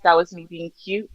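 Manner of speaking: usual speaking voice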